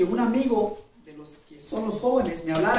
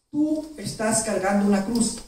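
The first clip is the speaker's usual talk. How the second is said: normal talking voice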